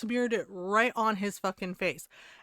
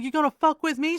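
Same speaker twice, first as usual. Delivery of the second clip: loud, higher mock yell; imitated yelling; 6 semitones higher, 7 dB louder than usual. Woman confronting a prankster, imitated yell. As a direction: act out an angry challenge. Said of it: "she's yelling at him".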